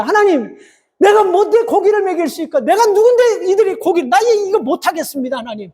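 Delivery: high, exasperated complaint; complaining to God. Retold or imitated. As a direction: throw up your hands and protest. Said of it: "따지고 드는 모습들".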